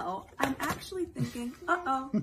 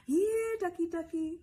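coaxing call; 7 semitones higher than usual. Acted. Calling the duck in story.

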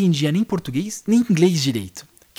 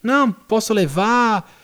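smooth-talking hustler; 5 semitones higher than usual. Retold or imitated. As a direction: lay on the hustler's easy patter, pitched up. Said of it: "Com um papinho mole".

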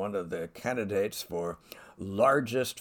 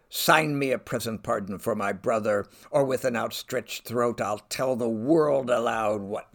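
louder, with open defiance; defiant shout; 3 semitones higher, 7 dB louder than usual. Acted. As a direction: hurl it as a public threat. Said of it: "her shouting defiance".

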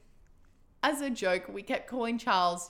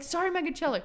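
high-pitched flustered mock apology; stumbling apology; 8 semitones higher, 8 dB louder than usual. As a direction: trip over the name while apologizing. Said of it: "you kept stumbling over".

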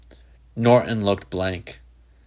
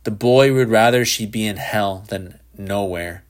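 plain reading voice

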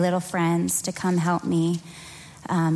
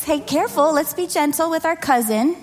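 sweet voice to the little ones; 8 semitones higher than usual. Real, not acted. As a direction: coax the toddlers kindly. Speaking to small children at front.

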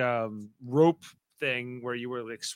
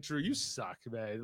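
muttered jab; 5 semitones lower, 14 dB quieter, slower than usual. Retold or imitated. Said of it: "I was like".

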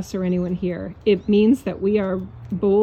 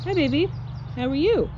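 much higher, tender greeting to her horse; 7 semitones higher than usual. Real, not acted. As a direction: coo sweetly at the horse.

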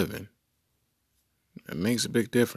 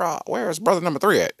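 higher, quicker editor voice; a quick editor demanding answers; lightly voiced old-time editor; 1940s editor; 10 semitones higher, 5 dB louder, faster than usual. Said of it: "newsroom editor".

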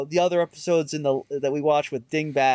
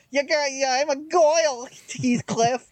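put-on high-pitched gangster moll accent; rough attempt at a Harley Quinn accent; [name]'s mean cat accent; 8 semitones higher than usual. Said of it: "the mean cat accent".

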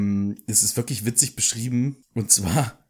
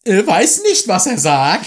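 high, loud proclamation; hammed-up public announcement; 7 semitones higher, 7 dB louder than usual. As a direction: proclaim it grandly over everyone. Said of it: "verkündete Lockhart".

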